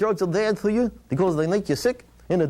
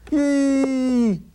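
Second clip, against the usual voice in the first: loud high "yee" whoop; 4 semitones higher, 4 dB louder than usual. Acted. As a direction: cap it with a whoop. Rhyme finale whoop.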